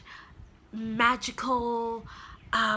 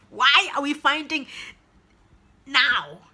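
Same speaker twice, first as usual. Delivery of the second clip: same question out loud, higher and louder; amazed; 4 semitones higher, 8 dB louder than usual. Real, not acted. Reviewer marveling at the author, now loud and high. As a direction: burst out with it in disbelief. Repeats whispered question aloud.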